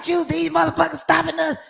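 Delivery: high-pitched agitated complaint, retold; mad as hell. Retelling her call to Juice about being stopped, mad as hell. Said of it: "I had called Juice mad as hell".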